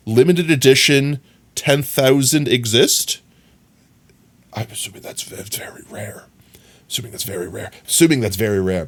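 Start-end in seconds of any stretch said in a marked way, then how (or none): 4.5-6.2 whisper. Real, not acted. Muttering to himself over game item. 6.9-7.7 barely voiced, as if to yourself; hushed under breath. Shown as a demonstration. Streamer murmuring about a rare item, very soft. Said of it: "repeats it aloud right after".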